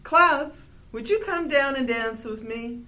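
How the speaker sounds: calling out to the clouds above. A narrator's reading. Bear calls up to the clouds to dance. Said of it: "he called to the clouds".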